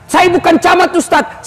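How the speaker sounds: excuse-making congregant. Retold or imitated.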